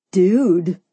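falling, confirming "Dude"; answering. A narrator's reading. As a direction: answer knowingly, pitched under the first. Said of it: "Simon answered".

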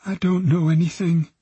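put-on high, fearful girl's voice; whimpering. A narrator's reading. Frightened girl answers the detectives, whimpering. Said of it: "she whimpered".

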